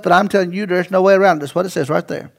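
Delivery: ordinary talk, nothing marked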